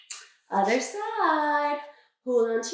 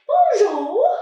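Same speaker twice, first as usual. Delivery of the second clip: playful loud bonjour; 8 semitones higher, 9 dB louder than usual. Pretend. Playful French greeting to leg.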